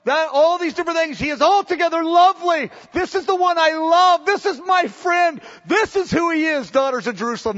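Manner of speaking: rapturous building praise; passionate praise. Retold or imitated. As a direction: proclaim him with all her heart. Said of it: "She goes".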